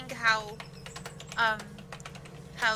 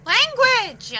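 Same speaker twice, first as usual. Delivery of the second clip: scolding shout; 12 semitones higher, 11 dB louder than usual. Acted. Scolding someone for swearing.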